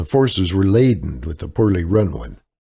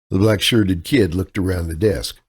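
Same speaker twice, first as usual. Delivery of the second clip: ordinary delivery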